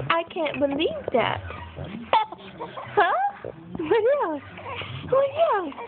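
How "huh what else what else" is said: much higher playful squeal-like cooing. Real, not acted. Mother cooing at laughing baby, high playful cooing. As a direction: coo up high to make her laugh.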